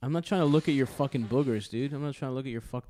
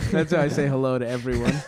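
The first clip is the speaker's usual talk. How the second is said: laughing; 7 dB louder than usual. Real, not acted. Laughing joke line.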